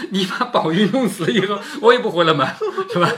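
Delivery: amused, laughing paraphrase. Real, not acted. Lecturer explaining Daiyu's dramatic threat to the class, laughing. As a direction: chuckle through the explanation.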